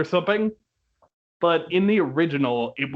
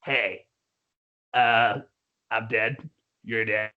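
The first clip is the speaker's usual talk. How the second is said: Beetlejuice invitation; 4 semitones lower, slower than usual. Retold or imitated. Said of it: "Beetlejuice comes out and is like".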